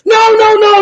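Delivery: high crying shout of no; Dorothy's crying plea. Retold or imitated. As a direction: cry out desperately to save the dog. Dorothy pleading over her dog.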